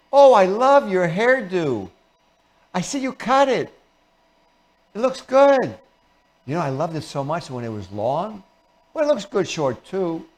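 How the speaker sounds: sugary, high-pitched fake compliment; covert hostility. False friend praising a haircut, backhanded sweetness. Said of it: "covert hostility".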